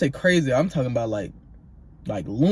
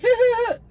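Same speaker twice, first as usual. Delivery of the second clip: high-pitched mock cackle; mimicked sleep laughter; 3 dB louder than usual. Roommate laughing while fast asleep, high mimicked laughter. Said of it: "laughing in his sleep".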